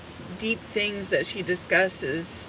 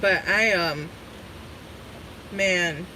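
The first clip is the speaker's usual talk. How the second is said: ordinary talk, nothing marked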